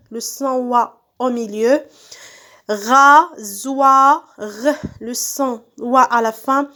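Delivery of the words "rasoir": Rasoir split into stretched, separate beats; syllable by syllable. Shown as a demonstration. Teacher cutting words into syllables, syllable by syllable. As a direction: sound out Rasoir as RA, SOI, R. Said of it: "je découpe les mots en syllabes".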